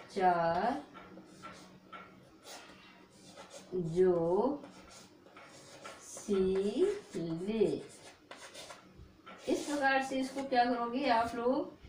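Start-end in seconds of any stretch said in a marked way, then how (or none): 0.1-7.6 चार, जो, सी, ले with long pauses between; slow syllable dictation. Shown as a demonstration. Teacher writes mnemonic on the board, dictating slowly. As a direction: dictate each piece separately and slowly. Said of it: "यहाँ पे".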